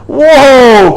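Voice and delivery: high, loud startled whoa; startled cry. A narrator's reading. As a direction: yelp it as the sleigh lurches. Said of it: "cried Santa".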